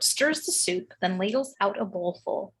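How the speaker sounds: ordinary delivery, nothing marked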